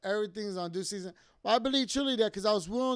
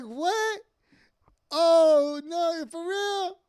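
high, loud, stretched-out amazement; slow, soaring incredulous reaction; stunned, excited disbelief; 9 semitones higher, 8 dB louder, much slower than usual. Retold or imitated. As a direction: imitate a partner overcome with surprise. Imitating partner's stunned reaction to homecoming.

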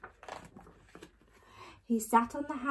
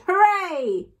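loud, raised cheer; bellowed cheer; 7 semitones higher, 8 dB louder than usual. A narrator's reading. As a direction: bellow it out in triumph. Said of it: "he bellowed".

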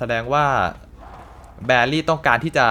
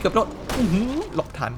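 startled yelp; 9 semitones higher than usual. Real, not acted. Dodging a lunging enemy in-game.

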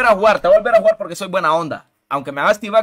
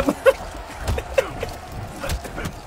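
breathless high-pitched giggle; high laugh; 11 semitones higher, 5 dB quieter than usual. Real, not acted. Streamer cracking up at a rival's play, airy giggle.

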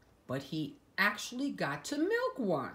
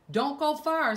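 higher, louder motherly warning; Mom's voice; 10 semitones higher, 5 dB louder than usual. Acted. Mom calling after Doug, higher and louder. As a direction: call it out like a mom. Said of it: "said his mom".

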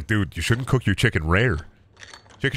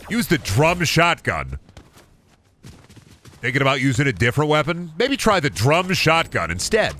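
mock ad read; 5 semitones higher than usual. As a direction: sell the drum shotgun like a cheesy commercial. Mock ad for drum shotgun.

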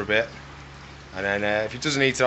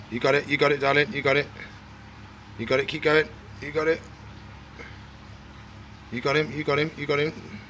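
encouraging; 3 semitones higher, 5 dB quieter than usual. Real, not acted. Encouraging lizard softly.

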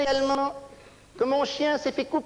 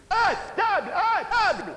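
calling to the table; 6 semitones higher than usual. Acted. Stomach calling out for food.

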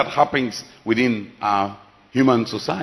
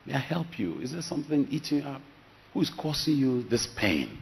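much softer, tender asking; gentle quiet question; 11 dB quieter than usual. Retold or imitated. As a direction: ease the words out to a weeping woman. Pastor gently asking crying woman.

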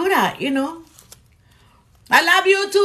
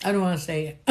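quiet laugh; 8 semitones lower, 7 dB quieter, much faster than usual. Real, not acted. Embarrassed laughing aside.

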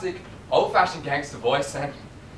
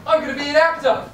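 high, louder proud announcement; excited Southern-free boast; 12 semitones higher, 5 dB louder than usual. Real, not acted. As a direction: burst out with the news.

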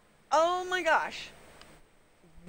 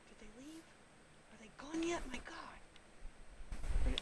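hushed unvoiced whisper; hiding and whispering from the bears; 13 dB quieter, slower than usual. Real, not acted. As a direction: whisper so the bears can't hear. Hiding, checking if bear gone.

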